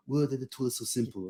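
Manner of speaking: put-on director modelling a British accent. Director coaching a kid to speak British, British accent. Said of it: "Ray Fiennes telling him".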